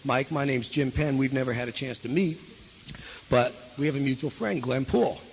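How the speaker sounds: low, soft introduction to a referral; quiet, low-key phone manner; phone technique. Shown as a demonstration. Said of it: "That's technique, right?".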